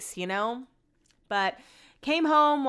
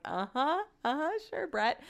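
sarcastic uh-huh; 7 semitones higher, 5 dB quieter than usual. Retold or imitated. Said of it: "we're all like".

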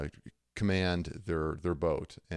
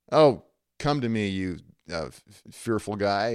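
louder, mock-exasperated invitation; frustrated; 6 dB louder than usual. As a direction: grudgingly summon a coward, putting him down. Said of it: "like, frustrated".

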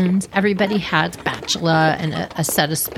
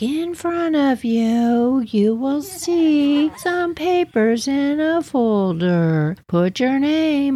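higher, slow teacher voice; frail teacher voice; 7 semitones higher, much slower than usual. Retold or imitated. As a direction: explain the folder like a tiny timid teacher, slowly. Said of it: "she starts explaining".

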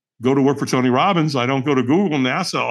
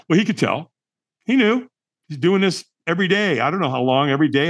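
ordinary delivery

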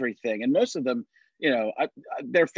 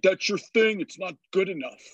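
mocking critics; 5 semitones higher than usual. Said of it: "people are like".

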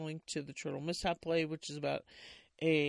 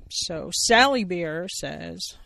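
story narration; 13 dB louder, much slower than usual. A narrator's reading. Reading play narration.

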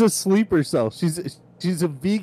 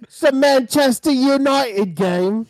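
higher, slow hammy British football fan; mock British accent; 6 semitones higher, 3 dB louder, slower than usual. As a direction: put on a cheesy Mancunian voice.

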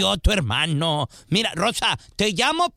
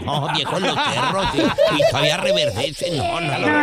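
laughing denial; 8 semitones higher, 3 dB louder than usual. Acted. Caller denies being old, laughing.

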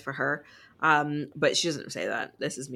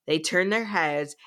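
normal talking voice

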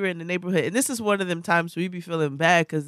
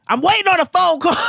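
high, loud mock scream; screaming mother; 10 semitones higher, 6 dB louder than usual. Mother yelling at kid on the phone line, screaming. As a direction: yell it like an irate mom. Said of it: "where your mother screams at you".

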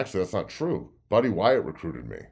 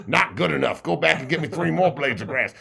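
louder, higher coach order; demanding coach; 7 semitones higher, 5 dB louder than usual. Retold or imitated. Coach sending player back for grass, loud order. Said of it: "walk over to me and go".

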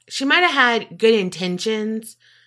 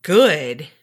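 low, firm anger; real anger held low in the voice; angry voice; 7 semitones lower, 3 dB louder than usual. Real, not acted. Co-host on hearing they were charged, low angry voice. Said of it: "I got my angry voice on".

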